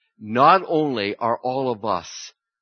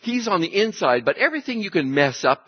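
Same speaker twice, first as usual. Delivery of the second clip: normal talking voice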